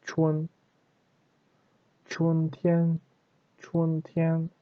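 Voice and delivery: "Chun" as "chwen", high and level, like "tian"; correct chun. Shown as a demonstration. Teacher repeats the proper reading, correct chun. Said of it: "like this".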